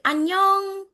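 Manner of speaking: high, sing-out Korean hello; imitated Korean greeting. Teacher showing how Koreans say hello, high imitated greeting. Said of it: "they're going to be saying".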